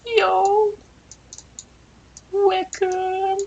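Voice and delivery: very high cartoon greeting. [name] greeting the small elephant in a very high voice. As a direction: toss it out high and cool.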